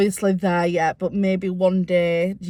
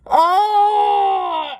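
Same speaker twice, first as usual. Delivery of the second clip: loud crying oh, higher; crying wail; 12 semitones higher, 7 dB louder than usual. Real, not acted. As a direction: wail out a sob. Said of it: "blared out my cry fit".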